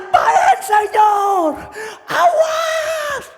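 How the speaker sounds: very high, stretched-out warning cry; shouting. Acted. Worker warns the unhearing engineer, shouting. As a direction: holler and soar up on the last word. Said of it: "Dia teriak lagi".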